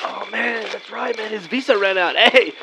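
usual speaking voice

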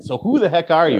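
high, punchy host teaser; excited. Acted. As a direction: announce it like a show opener. Podcast intro teaser question.

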